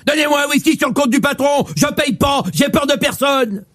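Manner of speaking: swagger like a little bully; mock tough guy. Skinny tough guy demands.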